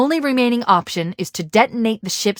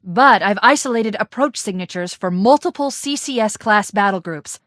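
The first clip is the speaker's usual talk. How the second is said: ordinary delivery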